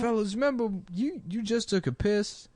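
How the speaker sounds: Steve Harvey impression. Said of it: "Fellas, remember when you was younger".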